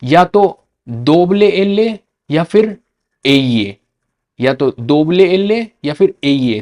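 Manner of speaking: दोबले एले and एये, slow, stretched; Spanish letter name. Shown as a demonstration. Teacher to students, slow Spanish double l. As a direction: spell out दोबले एले slowly. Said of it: "double L को क्या बोलते हैं".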